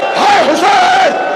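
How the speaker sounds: long, high, loud lament cry; drawn-out wail. Acted. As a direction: stretch it into a mourning wail.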